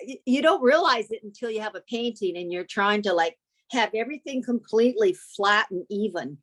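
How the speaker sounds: ordinary delivery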